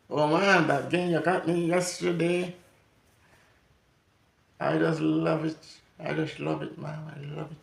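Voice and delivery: oversell the love so it's fake; sarcastic toddler. Toddler thanking parent for a gift, sarcastically.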